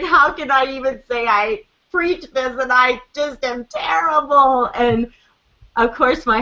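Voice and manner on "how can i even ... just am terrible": higher, exaggerated self-reproach; hammed-up retold lament; mock despair. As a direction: whine it in comic self-blame. Retold self-blame, husband laughing.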